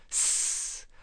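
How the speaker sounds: unvoiced hiss through the teeth; the snake hisses before answering in anger; angry snake hiss. Snake hisses angrily at the nosy frog. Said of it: "répondit avec colère".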